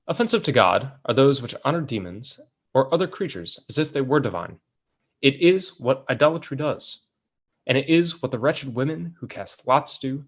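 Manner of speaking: put-on measured, declaimed reading of an old sermon; storyteller's deliberate, lilting delivery; formal text reading. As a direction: read it out like a solemn treatise, phrase by phrase. Reads old text aloud.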